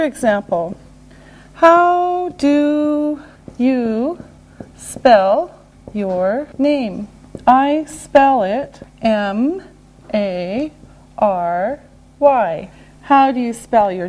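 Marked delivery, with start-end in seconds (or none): 1.5-12.7 drawn-out words, letter by letter; slow and deliberate. Shown as a demonstration. Teacher modelling name spelling, very slowly. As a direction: stretch and separate each word and letter.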